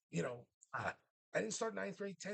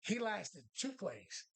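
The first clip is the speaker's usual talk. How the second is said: ordinary delivery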